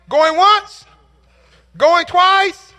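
loud, high auctioneer chant; an auctioneer closing the bidding, loud and high; auctioneer's call. Retold or imitated. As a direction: ring it out over the room like a gavel call. Auctioneer calls the final bids.

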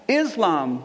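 usual speaking voice

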